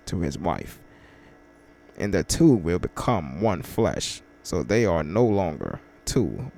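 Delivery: low, slow, soft Bible reading; scripture reading. Acted. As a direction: read the verse gently and deliberately. Reads scripture aloud.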